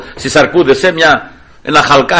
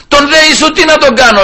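high-pitched, raised-voice question; Pilate; 10 semitones higher than usual. Acted. Pilate asks the crowd about Jesus, calling out. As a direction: call the question back over a mob. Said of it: "λέει ο Πιλάτος".

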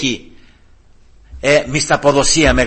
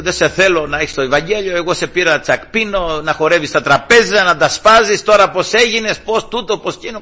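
higher, louder mock nagging; nagging wife; 6 semitones higher, 4 dB louder than usual. Wife rejects her changed husband, mock nagging. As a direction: imitate a wife piling up complaints. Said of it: "γκρίνια, γκρίνια".

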